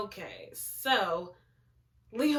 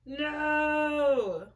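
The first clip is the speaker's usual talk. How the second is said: dismayed no; 6 semitones higher, 3 dB louder than usual. Real, not acted. Reacting to character trapped.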